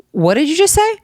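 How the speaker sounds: high-pitched, disbelieving question; incredulous. Retold or imitated. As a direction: re-enact stunned disbelief, pitch way up. Acting out her incredulous reply.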